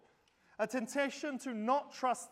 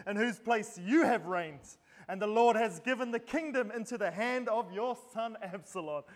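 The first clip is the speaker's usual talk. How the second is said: loud accusing shout, easing toward the end; forceful, louder quoted tirade; shouted curse; 5 dB louder than usual. Retold or imitated. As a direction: cry it out like an enemy hurling insults. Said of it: "And he shouted".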